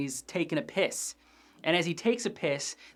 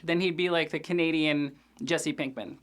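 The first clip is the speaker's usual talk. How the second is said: normal talking voice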